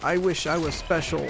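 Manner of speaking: high, whiny wish. Acted. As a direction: whine it like a sad kid. Whiny high self-pity.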